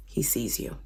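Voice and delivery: hushed, ominous; whispered warning. Retold or imitated. Laban warns Jacob the Lord watches, whispered. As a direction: breathe it as a threat.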